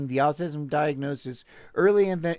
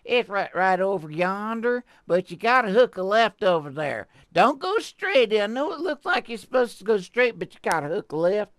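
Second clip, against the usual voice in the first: giving directions in a hammed-up Texan twang, higher; fake Texan accent; 6 semitones higher, 3 dB louder than usual. Telling listeners the way to the theater, in a fake Texan accent. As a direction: play a folksy Texan pointing the way. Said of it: "my fake accent is a real fake accent".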